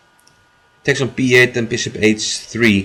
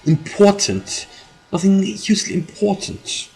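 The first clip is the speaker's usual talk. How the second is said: slow, higher mock British accent; 8 semitones higher than usual. Streamer repeats his joke line in a mock British accent. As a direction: play it up like a proper Brit. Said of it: "British accent there?".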